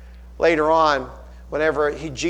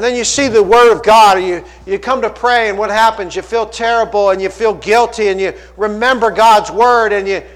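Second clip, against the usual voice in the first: loud, raised, driving preacher's voice; impassioned preaching; 6 semitones higher, 8 dB louder than usual. Real, not acted. Preacher confronts listeners with their guilt, impassioned loud preaching. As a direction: press each clause on the congregation at full voice. Preacher presses congregation with conviction.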